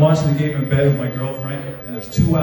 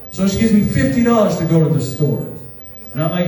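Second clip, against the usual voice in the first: ordinary delivery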